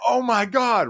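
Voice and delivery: high-pitched, imitated group astonishment; retold amazement. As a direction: act out their mind-blown exclamation up high. Voices the group's amazed reaction.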